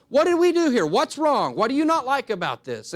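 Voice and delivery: suddenly alarmed at a harmless word; alarmed questioning. Retold or imitated. Spouse reads into fine.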